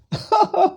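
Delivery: high-pitched short chuckle; laughing. Real, not acted. Lecturer amused by an opponent's argument, laughing.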